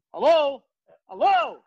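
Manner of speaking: loud, rising phone shout; shouting hello. Retold or imitated. Prankster yelling into the fake phone call, loud shouts. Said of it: "para de grito".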